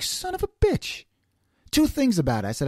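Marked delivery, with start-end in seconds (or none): 0.0-1.0 high, slow, strangled exasperated curse. Retold or imitated. Driver reacting to road-raging tailgaters, exasperated high curse. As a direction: let it out in disbelief, drawn out and high. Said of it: "And I'm like".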